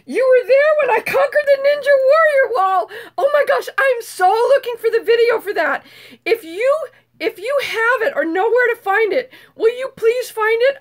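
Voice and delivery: loud, high-pitched excited outburst; excited gushing. Real, not acted. Gushing over wall climb live.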